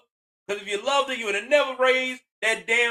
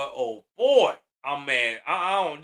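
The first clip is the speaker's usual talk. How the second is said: ordinary talk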